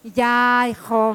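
normal talking voice

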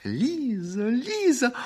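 high delighted calling of a name; joyful cry. Acted. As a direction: call her name in raptures. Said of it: "издали радостный крик изумления".

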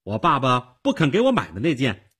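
put-on high, loud girl's voice; calling down. A narrator's reading. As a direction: project it down to the cellar. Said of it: "他对着下面的地窖说". (朝地窖高声说)

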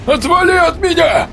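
desperate high scream; shouting. Acted. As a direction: yell it in pain and panic. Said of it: "закричал мужчина".

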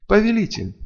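high, revived cry to a master; fervent address to a master; voice full of life. A narrator's reading. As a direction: call out with returning strength. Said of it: "Теперь голос полнила сила и жизнь".

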